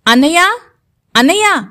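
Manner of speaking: calling her brother across the house, rising; calling out loudly. Acted. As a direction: call out for her big brother. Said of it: "పెద్ద పెద్దగా పిలుస్తుంది".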